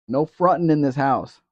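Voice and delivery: loud house-rule announcement; calling out. Real, not acted. Host laying down a house rule, calling out loudly. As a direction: call it out well above talking level.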